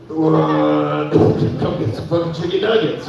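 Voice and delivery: slow, lightly voiced tempting devil. Chick-fil-A as the shoulder devil, slow tempting voice. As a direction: drawl it slow like a mild devil. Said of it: "who's the devil in this scenario".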